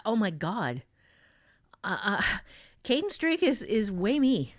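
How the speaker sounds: ordinary talk, nothing marked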